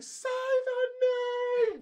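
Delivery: high, drawn-out heckler yell; mock yell. Girl yelling at the presenter from the front row, mock yell. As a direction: imitate a furious shout from the audience, high and stretched.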